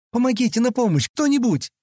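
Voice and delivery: high-pitched read cry for help. A narrator's reading. Girl calling for anyone to help, urgent high cry. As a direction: call out for help, high but not full volume. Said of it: "Крик повторился".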